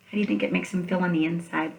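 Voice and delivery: ordinary talk, nothing marked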